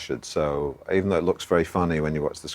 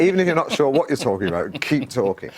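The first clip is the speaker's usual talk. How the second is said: Alan Partridge voice; 8 semitones higher, 5 dB louder than usual. Retold or imitated. Advice delivered as Alan Partridge.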